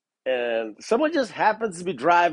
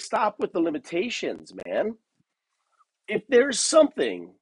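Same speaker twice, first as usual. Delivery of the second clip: normal talking voice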